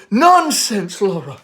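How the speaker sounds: brushing off her daughter's fear; Amanda, brisk mother. Acted. Mother scolding daughter for calling herself crippled, insistent.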